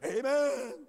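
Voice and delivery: drawn-out, loud mock amen; mock drunk preacher. Mocking an online teacher getting drunk, slow hammed-up drunken amen. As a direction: stretch it out like a tipsy podcaster. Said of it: "Might be sitting there getting drunk".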